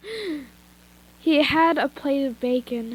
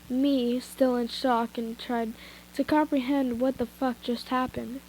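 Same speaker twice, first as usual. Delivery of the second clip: ordinary delivery, nothing marked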